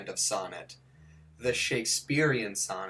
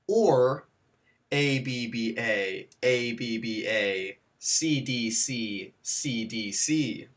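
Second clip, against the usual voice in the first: slow, spelled out; slower than usual. Shown as a demonstration. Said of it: "two types of rhyme scheme".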